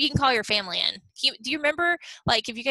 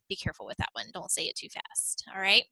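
usual speaking voice

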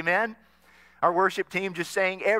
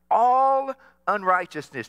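loud, stressed "all unrighteousness" to lead the response; emphatic call; 4 semitones higher, 8 dB louder than usual. Shown as a demonstration. Pastor calling the church to repeat the word, loud. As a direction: hit "all" hard so the room echoes it. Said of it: "say that word".

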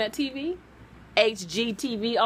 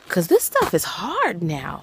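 ordinary talk, nothing marked

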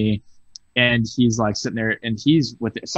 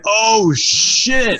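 high, loud oh shit; startled as if the room froze; shocked reaction; 11 semitones higher, 5 dB louder than usual. Retold or imitated. Worker stunned as Carl insults the customer, high and loud. Narrator reacts to tense silence.

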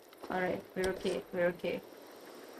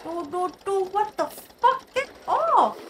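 much higher and louder, climbing to a shriek; panicked shout; 11 semitones higher, 4 dB louder, slower than usual. Real, not acted. Gamer grabbed by a monster, shrieking in panic. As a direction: scream get off in real terror.

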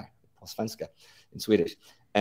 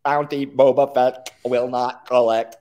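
louder, slow, clipped robot announcer; wisecracking droid; 3 semitones higher, 5 dB louder, slower than usual. Shown as a demonstration. Showing the host his Star Wars droid voice, loud and slow. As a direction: deliver it like a smug droid announcer. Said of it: "I did the voice".

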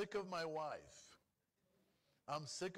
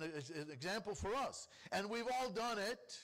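usual speaking voice

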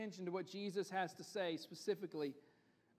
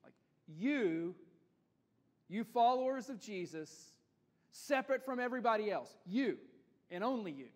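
every "you" pushed out louder; stress on you; 3 semitones higher, 7 dB louder than usual. Shown as a demonstration. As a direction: repeat "you", hitting it each time. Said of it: "the emphasis there ... is on the word you".

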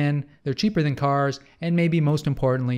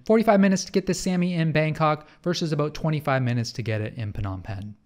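ordinary talk

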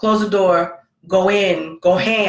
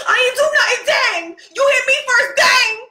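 put-on high, loud whiny little boy; whiny kid; 6 dB louder than usual. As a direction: whine like a kid protesting innocence. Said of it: "[name]'s in the background going".

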